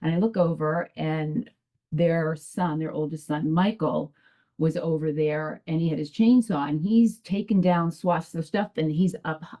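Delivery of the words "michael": usual speaking voice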